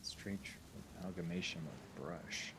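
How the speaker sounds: whispered, breathy awe; whispering. Acted. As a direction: breathe the words out in mock wonder. Repeating GM's odd phrase.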